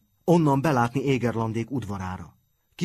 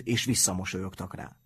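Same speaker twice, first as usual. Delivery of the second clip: plain reading voice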